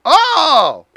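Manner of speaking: loud, high drawn-out aha; acted revelation. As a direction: react as if it all suddenly clicks, big and loud. Acts his reaction to client's admission.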